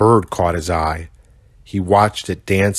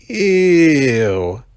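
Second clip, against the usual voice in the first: disgust; 7 semitones higher, 4 dB louder than usual. Acted. Rhino disgusted at eating.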